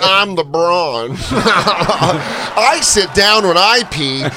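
slow, high mock-presenter delivery; corny pitch intro. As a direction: stretch it like a rehearsed cheesy couple joke. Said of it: "they still have the corny thing though".

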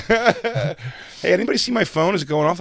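high-pitched mock innocence; feigned innocence. Acting innocent about the hidden phone.

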